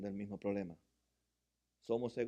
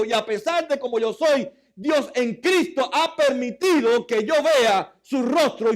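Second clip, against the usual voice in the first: high, loud preaching; fiery sermon delivery; preaching at full force; 12 semitones higher, 15 dB louder than usual. Acted. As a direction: preach it out to the congregation.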